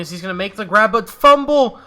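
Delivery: rising, loud shout on the fumble; alarmed play call. Real, not acted. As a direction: call it excitedly, then yelp as it goes wrong. Fumble during live game call.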